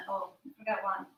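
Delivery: ordinary talk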